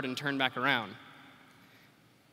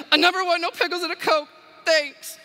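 much higher and louder, blurted out in tears; tearful shout; 11 semitones higher, 7 dB louder than usual. Retold or imitated. Woman bursting into tears, shouting her order at the cashier. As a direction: shout the order as you break down crying. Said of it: "burst into tears and shouted her order at me".

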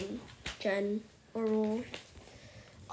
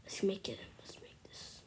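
hushed sneaking whisper; 5 semitones lower, 13 dB quieter than usual. Real, not acted. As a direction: whisper it like you're hiding. Sneaking in game.

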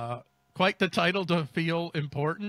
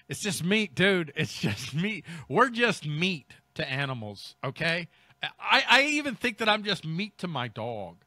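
ordinary talk, nothing marked

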